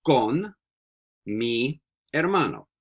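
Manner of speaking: Yo vivo con mi hermano drawn out word by word; slow, deliberate teacher modeling; slow model sentence. Shown as a demonstration. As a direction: spell it out slowly for a learner. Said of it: "So you can say".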